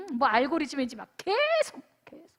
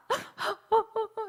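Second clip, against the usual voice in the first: a higher, breathy sobbing gasp; imitated sobbing gasp; 7 semitones higher, 3 dB quieter than usual. Pastor imitating the boy breaking down, a sobbing gasp. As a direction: mimic the boy's sudden sob. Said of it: "이렇게 흐느끼더니".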